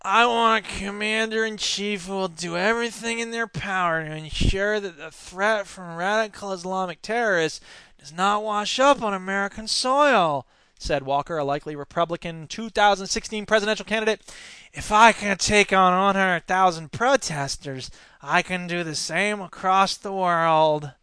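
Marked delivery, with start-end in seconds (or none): 0.0-10.5 whiny, sniveling guy; Scott Walker. Retold or imitated. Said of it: "I should do it like a whiny, sniveling guy". 14.7-20.9 slow, whiny, sniveling politician impression; Scott Walker. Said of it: "I should do it like a whiny, sniveling guy".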